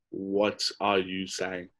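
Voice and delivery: mock bafflement. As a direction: imitate someone slowly failing to understand. Acting out baffled listeners.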